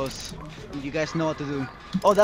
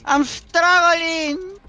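high, stretched panicked cry; panicked yell; 13 semitones higher, 5 dB louder, much slower than usual. Real, not acted. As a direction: strain out in a panic. Caught, in-game panic.

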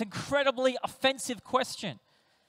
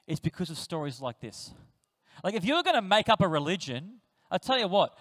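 ordinary talk

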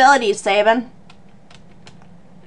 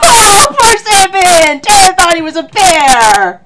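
high, loud amused wail; high, hammy 'poor thing' exclamation; laughing sympathy; 6 semitones higher, 5 dB louder than usual. Real, not acted. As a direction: cry out in pity through laughter. Laughing at mistaken-bear joke.

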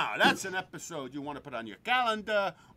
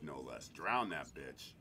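ordinary talk, nothing marked

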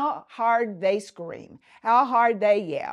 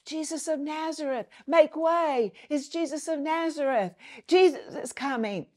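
higher, eager voices of the crowd clearing a path; excited crowd voice; 7 semitones higher than usual. Retold or imitated. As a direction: call it out like someone in the crowd announcing Jesus. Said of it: "Maybe people were saying".

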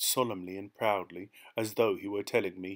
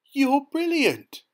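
thrilled praise; 9 dB louder, slower than usual. A narrator's reading. Narrator thrilled, praising the thief.

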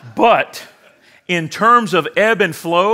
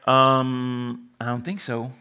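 playful refusal; 3 semitones lower, 4 dB quieter, slower than usual. Retold or imitated. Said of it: "And I'm like".

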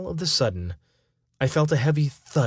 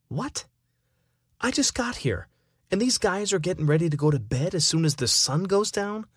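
surprised; 6 semitones higher than usual. Acted. Shocked the party is ending.

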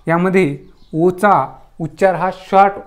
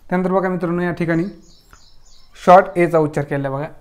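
ordinary delivery

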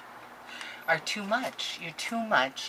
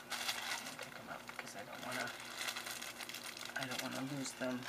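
ordinary talk, nothing marked

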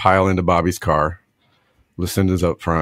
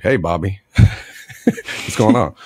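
Southern drawl; 3 semitones lower than usual. Retold or imitated. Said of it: "give everybody a Southern accent".

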